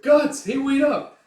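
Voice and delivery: high, loud shout; acted urgent call from behind; calling after someone. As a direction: holler for someone to wait. Puck calls after departing Guts.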